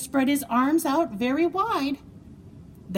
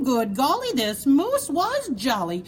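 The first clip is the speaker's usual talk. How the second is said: plain reading voice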